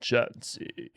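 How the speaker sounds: low, soft, breathy crooner attempt; half-whispered low soul-singer purr. Shown as a demonstration. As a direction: drop it deep and hushed, like a soul singer.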